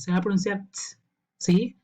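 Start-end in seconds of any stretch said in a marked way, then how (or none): 0.7-1.0 "ts" as a crisp t sound with no voice; voiceless t. Shown as a demonstration. Teacher shows ED after voiceless sound, bare unvoiced "ts". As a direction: release "ts" as just a puff of t. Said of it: "con el sonido que es voiceless".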